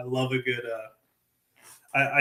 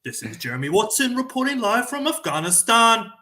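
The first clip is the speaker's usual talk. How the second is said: higher, louder newsreader voice in a put-on British accent; fake BBC broadcaster; 10 semitones higher, 6 dB louder than usual. Shown as a demonstration. For the host, a put-on BBC broadcaster voice. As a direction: announce it like a BBC man in the field. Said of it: "a fake B B C broadcaster".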